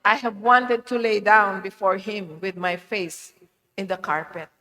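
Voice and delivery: ordinary delivery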